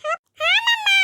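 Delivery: put-on high, loud little-boy voice; eager child. Boy agreeing to mother's rules, eager.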